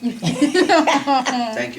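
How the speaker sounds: laughs out a high, loud thank you; laughing thanks. Real, not acted. [name] laughing off the coach's harsh verdict. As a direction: thank him through a real laugh.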